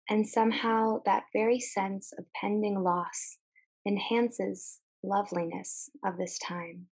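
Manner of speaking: calm, measured meditation voice with long pauses; guided meditation. Acted. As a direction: guide listeners gently, letting each phrase settle.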